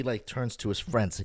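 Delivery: ordinary delivery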